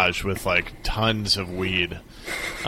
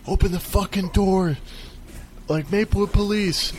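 high mock police demand; angry cop shout; 11 semitones higher than usual. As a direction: bang on the door as a cop. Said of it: "They're like".

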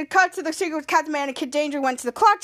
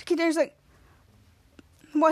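ordinary delivery

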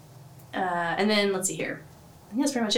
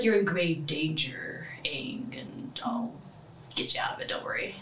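whispered quote; 4 semitones lower, 6 dB quieter than usual. Retold or imitated. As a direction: whisper it gravely to a brother. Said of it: "and he's like".

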